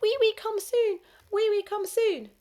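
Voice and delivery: put-on high, loud wailing toddler; a little girl calling out in distress; crying little girl. A narrator's reading. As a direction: wail it like a small child in the corridor. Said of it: "the little girl crying from the corridor".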